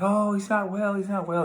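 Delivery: very high, loud fretting mother; flustered Miss Givings. Retold or imitated. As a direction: flutter anxiously to cover for her son. Miss Givings fretting, acted quote.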